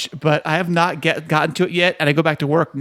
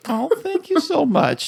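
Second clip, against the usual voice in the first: mock gracious thanks; 9 semitones higher, slower than usual.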